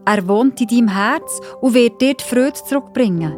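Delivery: plain reading voice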